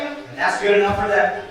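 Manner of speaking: ordinary talk, nothing marked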